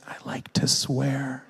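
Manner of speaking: imitating a crying child, slow and understated; mock tearful confession. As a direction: drag it out like a sad little confession. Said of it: "he cries, and he goes".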